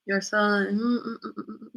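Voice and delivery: ordinary delivery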